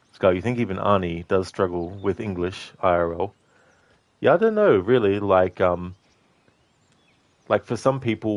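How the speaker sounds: ordinary talk, nothing marked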